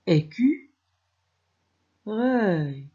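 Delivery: "Écu-, reuil" in two separate chunks; split into syllables. Shown as a demonstration. Teacher repeating the cut word for children, "Écu-, reuil" chunk by chunk. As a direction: lift "Écu-" high, pause, then "reuil". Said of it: "Découpons écureuil. On obtient".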